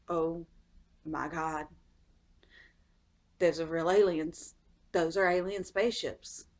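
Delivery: hushed, low, halting disbelief; stunned awe. Retold or imitated. Onlookers seeing the spaceships return, hushed stunned awe. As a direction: say it low and soft, stopping in amazement. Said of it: "they're all looking like".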